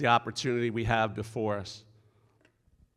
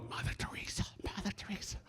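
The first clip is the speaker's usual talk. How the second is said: hushed, reverent whisper of her name; awed whisper; 9 semitones higher, 14 dB quieter, faster than usual. Retold or imitated. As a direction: whisper it in awe from the back seat. Awed passengers in back seat.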